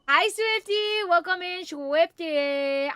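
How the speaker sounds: high, drawn-out happy welcome; excited greeting. Real, not acted. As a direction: sing out a welcome. Greeting a chat viewer.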